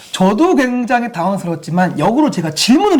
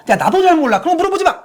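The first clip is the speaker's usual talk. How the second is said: annoyed parent; 10 semitones higher than usual. Retold or imitated. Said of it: "또는 뭐".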